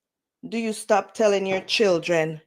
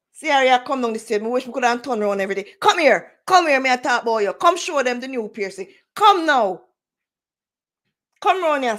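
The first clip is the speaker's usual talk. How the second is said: fast, loud calls to the daughter; calling out; 3 semitones higher, 5 dB louder, faster than usual. Real, not acted. Mother calling daughter down the stairs to show piercing, loud. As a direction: summon her over, loud and quick.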